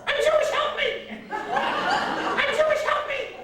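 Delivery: put-on very high squawking old lady; Missus Goldfarb. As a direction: screech a plea like a parrot. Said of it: "Then Missus Goldfarb".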